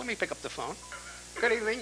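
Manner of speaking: ordinary talk, nothing marked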